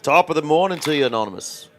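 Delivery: hammed-up stage Irish accent, a bit fast; bad Irish accent. Greeting the Irish-looking player in a deliberately bad Irish accent. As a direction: greet him in an over-the-top Irish accent. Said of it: "That was the worst Irish accent I think I've ever done".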